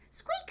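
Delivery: tiny, very high squeak; mouse squeak. Acted. Mouse climbing aboard, a very high squeak. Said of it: "said Mouse".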